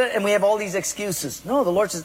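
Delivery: ordinary talk, nothing marked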